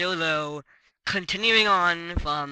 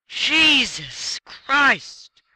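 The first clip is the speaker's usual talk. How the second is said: drawn-out exasperation; 8 semitones higher, 7 dB louder, much slower than usual. Real, not acted. Exasperated at annoying game partner.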